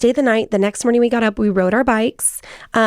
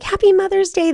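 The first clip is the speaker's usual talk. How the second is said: cheery greeting; 11 semitones higher than usual. Retold or imitated. Son greets mom on Mother's Day, imitated high cheery greeting. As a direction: imitate an excited high-pitched well-wisher.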